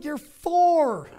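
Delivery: high, loud incredulous outburst; shocked parent yelling; exasperated disbelief. Retold or imitated. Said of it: "I'm like".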